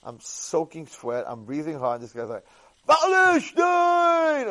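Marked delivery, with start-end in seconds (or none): 2.9-4.5 very high, loud, drawn-out call of a name; imitating a beggar's far-carrying high call. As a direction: hail him from across the room, stretched long. Poor man calls out again.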